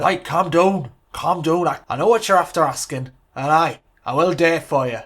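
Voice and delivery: put-on loud, firm Scottish-Irish hybrid accent; a mock Sir Alex soothing his assistant in a hybrid accent. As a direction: settle him down in a booming Scottish-Irish accent. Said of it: "Sir Alex has got an Irish accent".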